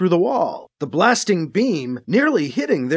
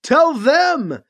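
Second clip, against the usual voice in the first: higher, louder shout over the noise; shouted order; 8 semitones higher, 4 dB louder than usual. A narrator's reading. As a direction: shout over gunfire to the team. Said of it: "Alibi shouted over the next blast".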